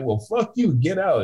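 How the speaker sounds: mock curse. Curse back.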